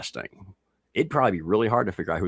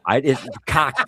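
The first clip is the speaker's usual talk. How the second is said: cock drawn out slowly and louder; stress on cock; 6 semitones higher, 5 dB louder, slower than usual. Shown as a demonstration. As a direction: lean hard on the word cock. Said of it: "We have more cock".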